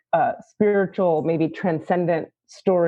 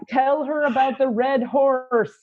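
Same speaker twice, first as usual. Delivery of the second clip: slow, higher channeling voice, like a message from beyond; 6 semitones higher, slower than usual. Retold or imitated. Channeling the divine to a student, slow and lifted. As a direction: pass on the spirit's message slowly. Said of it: "I'm channeling".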